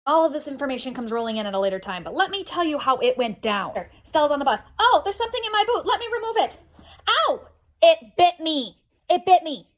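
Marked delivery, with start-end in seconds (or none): 4.8-9.7 put-on high-pitched kid voice breaking into a loud yelp; startled child. Daughter on the bus finding something in her boot, startled. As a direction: play a little girl stung by shock.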